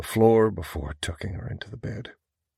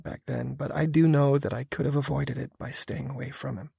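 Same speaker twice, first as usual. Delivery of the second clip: confessing woman; 8 semitones higher, 6 dB louder than usual. A narrator's reading. Female character explains.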